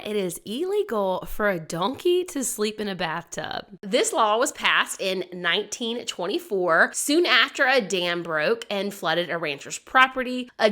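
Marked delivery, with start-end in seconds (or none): none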